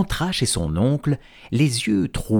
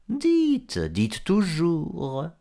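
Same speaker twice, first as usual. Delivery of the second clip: taunting; 7 semitones higher, much slower than usual. Acted. Renard taunting slowly.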